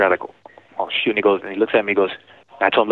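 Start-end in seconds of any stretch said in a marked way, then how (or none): none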